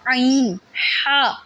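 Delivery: tight, strangled عین and ہا from a squeezed throat; harsh choked throat. Shown as a demonstration. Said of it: "ایسے کر کے نہیں".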